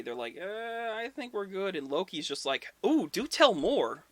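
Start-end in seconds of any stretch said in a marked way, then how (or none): 0.4-1.7 wary. Retold or imitated. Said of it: "everyone's a little weary". 2.9-4.0 intrigued. Retold or imitated. Loki eggs on the wall builder, loud intrigued delight.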